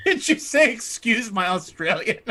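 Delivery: can't believe she said it; laughing disbelief. Real, not acted. Laughing at a friend's phrase.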